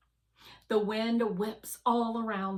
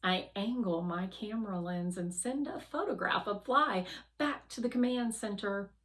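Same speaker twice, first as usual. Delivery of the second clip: plain reading voice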